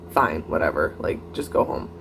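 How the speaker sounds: low, breathy, half-swallowed brush-off; imitating the date's sulky brush-off as she lets it go; fed-up dismissal. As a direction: mutter it like you've given up on her. Annoyed date finally gives up.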